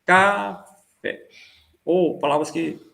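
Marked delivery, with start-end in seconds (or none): 0.0-1.3 "Café" stretched out, split as CA... fé; two-syllable pulse. Shown as a demonstration. Teacher showing a two-syllable word as pulse, syllable by syllable. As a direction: say "Café" slowly as two separate beats, the "Ca" held. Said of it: "palavras com duas sílabas".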